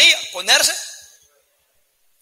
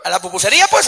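usual speaking voice

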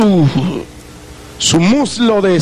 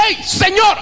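calling out; 10 semitones higher than usual. Retold or imitated. Calling out to the preacher.

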